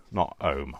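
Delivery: HOME said as 'ome, no H; Coventry 'ome for HOME; dropped H. Shown as a demonstration. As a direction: drop the H at the start of HOME. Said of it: "dropping my H's off the beginning of words".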